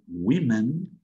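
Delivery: "women" slowly with an i sound, wimen; English women. Shown as a demonstration. Teacher modelling "women" slowly. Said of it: "como dijimos antes".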